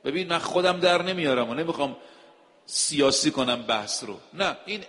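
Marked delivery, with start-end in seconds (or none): none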